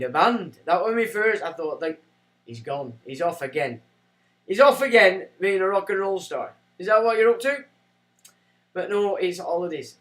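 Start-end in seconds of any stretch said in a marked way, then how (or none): 4.5-6.5 louder repeat. Acted. Repeats phrase, second louder.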